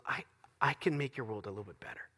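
very soft, hushed promise; tender offer. Retold or imitated. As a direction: offer help gently, almost under your breath. Voicing human spirit helping sufferer.

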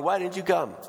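high-pitched mock wailing complaint; retold bawling. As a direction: imitate a tearful reproach. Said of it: "Everyone's bawling".

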